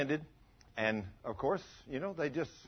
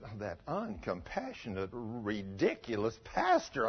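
muttering mother; 8 semitones lower, 7 dB quieter, slower than usual. Retold or imitated. Mother criticising pastor.

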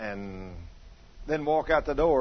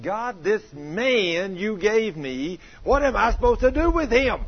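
slow, loud exasperated plea to God, much higher; grumbling at God; 8 semitones higher, 7 dB louder, slower than usual. Retold or imitated. Wife complaining to God about her husband, loud exasperated wail. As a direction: wail your frustration at heaven. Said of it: "she is grumbling at God".

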